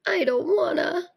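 a touch of a teary whine; tearful refusal. Acted. Lardy refusing the bath, mildly tearful whine. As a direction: whimper it like a scared little kid.

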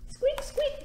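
very high little squeaks; mouse squeak. A narrator's reading. Teacher voicing a mouse for the children, squeaky and high. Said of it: "said Mouse".

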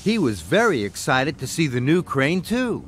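ordinary delivery

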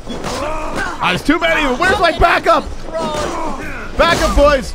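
high, loud panicked calling; shouting for backup. Real, not acted. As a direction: yell for your backup.

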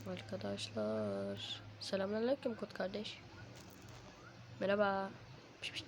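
normal talking voice

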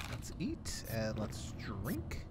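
slow whisper. Real, not acted. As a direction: whisper it slowly. Quiet after scare.

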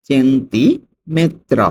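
very slow "সেন্টিমেত্র", split after "centi"; slow dictation of "সেন্টিমেত্র" for learners; broken into parts. Shown as a demonstration. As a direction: draw out "centi", pause, then "metro". Teacher repeats word by parts.

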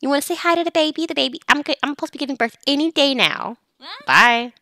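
high, sociable character voice; chatty expectant mother. Acted. Expectant mother showing off her baby bump, chatty.

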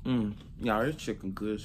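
talking while chewing; savoring food between words; mouth full, chewing. Real, not acted. Seen 4: holds chicken piece, lips pressed between words, jaw working while talking.

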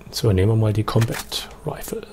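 soft whisper. Real, not acted. Gamer picking a new rifle, whispered. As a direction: say it under your breath. Quietly switching weapons.

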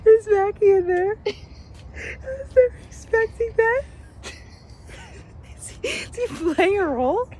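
can barely get it out for laughing; laughing hard. Real, not acted. Laughing hard while retelling.

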